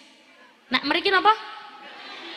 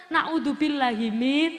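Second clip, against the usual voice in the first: ordinary talk, nothing marked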